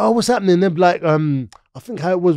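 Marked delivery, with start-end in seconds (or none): 0.0-0.6 put-on high, eager kid voice; young self voice. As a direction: play the naive twelve-year-old. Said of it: "I'm like".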